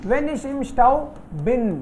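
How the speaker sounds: slow, clear correction; slow model sentence. Shown as a demonstration. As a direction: model When ich im Stau bin slowly. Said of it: "teacher models corrected sentence".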